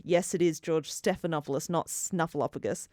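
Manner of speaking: ordinary delivery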